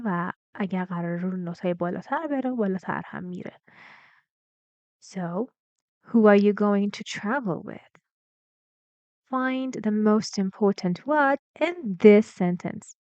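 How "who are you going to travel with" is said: louder model question falling from "travel"; firm question with a fall on "travel with"; falling intonation. Shown as a demonstration. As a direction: peak on "travel", then drop through "with". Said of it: "we need falling intonation".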